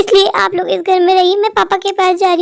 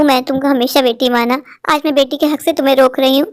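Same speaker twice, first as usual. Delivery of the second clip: a mother-in-law soothing her daughter-in-law; calm mother-in-law; 7 semitones lower than usual. Acted. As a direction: lower older woman's voice, gentle and reassuring.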